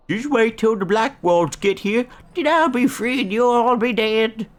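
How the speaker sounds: high, loud gloating threat; put-on high-pitched menacing yell; taunting captive villain. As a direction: shout it like a cackling game villain. Voices a threatening game character.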